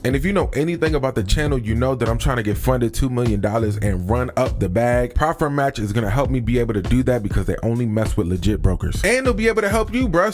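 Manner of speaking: upbeat sponsor read over music. Acted. Pitching Prop Firm Match to his channel viewers, energetic ad read. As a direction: sell it like a YouTube sponsor spot.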